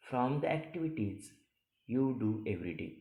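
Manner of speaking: usual speaking voice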